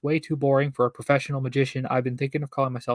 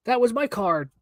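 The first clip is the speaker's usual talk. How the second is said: put-on amazed girl; 9 semitones higher than usual. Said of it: "she cried".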